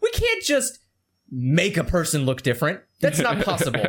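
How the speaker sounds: sarcastic studio excuse. Retold or imitated.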